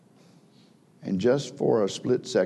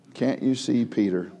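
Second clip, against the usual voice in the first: normal talking voice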